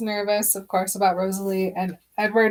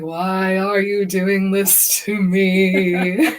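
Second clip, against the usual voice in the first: mock wail; much slower than usual. Bella quoted while crying.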